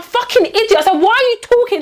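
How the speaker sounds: high-pitched indignant outburst; retold exasperation. Replaying a row over eating in the car, exasperated. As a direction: act out losing patience with a stranger. Retold roadside quarrel with a stranger.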